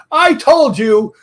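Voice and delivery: loud, high, drawn-out I-told-you; vindicated exclamation. Retold or imitated. Ghost-museum manager to the investigator, vindicated exclamation. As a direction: imitate her triumph, stretching each word high and loud. Retold vindicated reply to his report.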